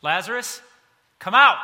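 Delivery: big commanding shout, rising; Jesus shouting into the tomb; loud call. Retold or imitated. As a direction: call him out of the tomb at full voice. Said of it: "called out in a loud voice".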